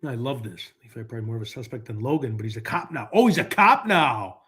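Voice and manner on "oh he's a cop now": slow, drawn-out oh of realization; knowing, amused realization; mock surprise. Real, not acted. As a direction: stretch it out like it just sank in.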